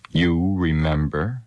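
slow astonished question; wondering aloud, slowly. A narrator's reading. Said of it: "speaking his thought aloud in his astonishment".

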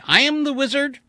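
high, loud proud proclamation; grand boast. Acted. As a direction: declaim it bitterly proud. Blenheim boasting.

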